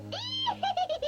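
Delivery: high wicked witch cackle; evil cackle. Acted. Villain gloats over trap.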